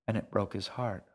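plain reading voice

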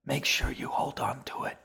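put-on whispered advice from an old woman. A narrator's reading. Old woman advises the boy about his name, whispering. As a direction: keep it all in a whisper. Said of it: "Lima whispered".

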